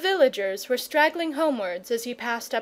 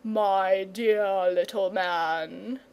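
slow, lower and grave dragon voice; solemn dragon; 3 semitones lower, much slower than usual. Acted. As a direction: lay it down gravely. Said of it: "said the dragon solemnly".

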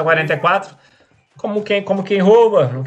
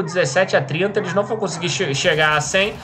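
usual speaking voice

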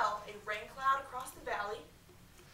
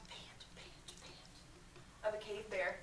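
whispered panting; 2 semitones lower, 10 dB quieter, much slower than usual. A narrator's reading. Imitated bear panting.

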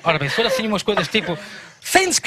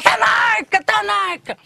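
a high shrieking call; high cry; 12 semitones higher, much slower than usual. Retold or imitated. Woman cries out again.

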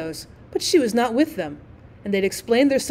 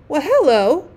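loud, rising surprised call; boy calling out at the window; sudden exclamation; 4 semitones higher, 6 dB louder, slower than usual. A narrator's reading. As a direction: blurt it out in surprise. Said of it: "suddenly exclaimed one of the twins".

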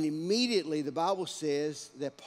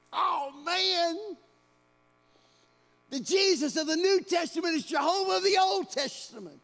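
loud, high cry of sudden awe; Paul's stunned realization; 14 semitones higher, 8 dB louder than usual. Acted. Paul realizing on the Damascus road who Jesus is, in loud awe. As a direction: gasp it out as the truth hits.

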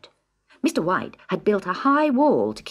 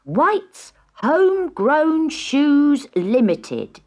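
sign read out; 8 semitones higher, 5 dB louder, much slower than usual. A narrator's reading. Reading the sign grandly.

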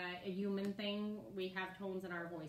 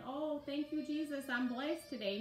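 joyful thanks; 6 semitones higher than usual. Retold or imitated. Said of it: "she wasn't like".

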